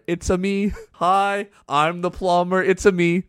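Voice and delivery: high, bouncy mock Mario voice. Host mocking the lyric as Mario for a cover. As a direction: ham it up like the plumber. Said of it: "in a Mario voice".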